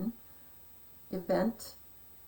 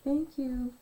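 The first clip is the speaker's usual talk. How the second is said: cooing; 6 semitones higher, slower than usual. Real, not acted. Answering the greeting, pet talk.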